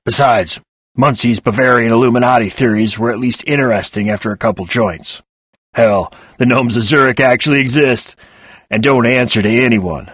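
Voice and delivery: loud, gravel-gargling voice; gravelly woman. Acted. Desiree cutting in on Penny, lightly voiced gravelly rasp. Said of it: "someone who had spent their childhood gargling gravel".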